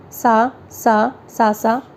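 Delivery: held note lengths. Shown as a demonstration. Teacher demonstrating andolan by holding सा slowly. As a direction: stretch सा long and slow, then shorter. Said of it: "गुरु व प्लु तथा अंतिम में लघु".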